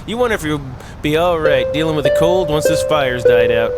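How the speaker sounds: usual speaking voice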